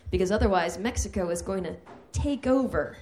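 ordinary talk, nothing marked

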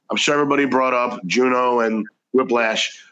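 ordinary talk

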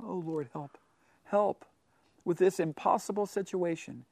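very soft, humble plea for help; soft humble cry. Shown as a demonstration. Preacher voicing a humble plea to the Lord, hushed. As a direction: plead quietly and humbly.

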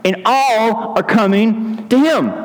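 high, drawn-out complaint; John's questioners. Acted. Followers report the rival's crowds to John, high drawn-out complaint. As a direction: stretch it out in a high voice. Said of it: "They came to John and said to him".

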